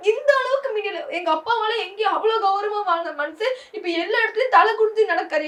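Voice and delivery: speaking through crying about her father. Real, not acted. Daughter tells interviewer of father's shame, crying. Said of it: "சரி, அழாத".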